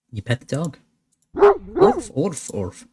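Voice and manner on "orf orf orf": squeaky mock woof, then fading; a happy little woof; dog woof. Voicing the dog.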